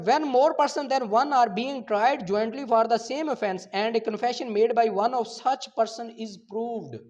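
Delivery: slow, loud, deliberate reading of legal text; statute reading. Acted. Lecturer reads the law aloud to his students, slow and emphatic. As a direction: read each clause out slowly, as if dictating.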